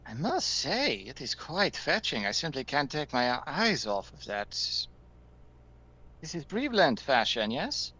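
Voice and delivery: put-on high, haughty French-accented noble; a bad French accent, fawning over the outfit. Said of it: "I will not go too far with my bad French accents here".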